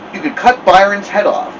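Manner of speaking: ordinary delivery, nothing marked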